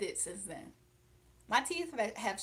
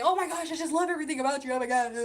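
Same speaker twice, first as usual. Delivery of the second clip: mock gushing fan; 6 semitones higher, 8 dB louder, faster than usual. Mocking gushing fans on her live.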